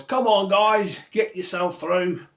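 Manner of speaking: loud, high, hammed-up Australian accent; bad Australian accent. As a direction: do a terrible Australian accent, big and cheery. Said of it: "Terrible Australian accent".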